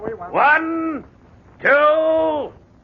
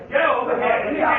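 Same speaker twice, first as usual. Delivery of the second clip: ordinary talk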